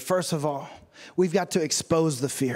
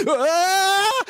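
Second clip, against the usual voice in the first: imitated panicked scream, climbing like a plane; loud, high mock wail that keeps rising; 12 semitones higher, 6 dB louder than usual. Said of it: "so did his voice as he went".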